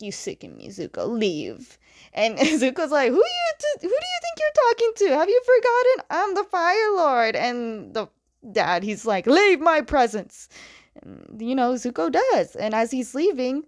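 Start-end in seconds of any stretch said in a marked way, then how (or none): 0.0-1.8 much lower, much softer contempt; the father coldly disowning Zuko, low and soft; cold disgust. Retold or imitated. 3.1-7.4 much higher, louder, fast outraged voice; fly into a shrieking rage; indignant outburst. Retold or imitated. Zuko exploding at father. 9.3-10.5 higher, louder imperious order; the father ordering Zuko out, louder; commanding dismissal. Retold or imitated.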